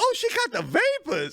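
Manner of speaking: high, hammed-up pleading to the Lord; praying lady. Lady at the stairs calls on Jesus, high hammed-up panic. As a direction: cry out to Jesus in mock panic.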